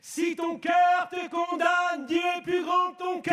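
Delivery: encore plus haut, plus fort et lent, martelé; reprise criée. Acted. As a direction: crie le verset comme un sommet. (clamé à pleine voix, lentement)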